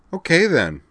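high, loud, perky; bright. A narrator's reading. Rena cheers up after making peace, brightly. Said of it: "she said brightly".

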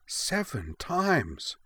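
high, slow, wide-eyed amazement; awed, drawn-out gasp of disbelief; astonished murmur. A narrator's reading. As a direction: let the amazement stretch each word. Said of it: "she murmured in astonishment".

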